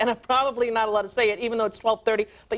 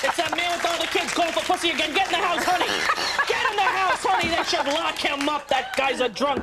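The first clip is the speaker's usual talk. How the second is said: gossiping neighbor; 5 semitones higher than usual. Retold or imitated. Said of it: "the neighbors are going".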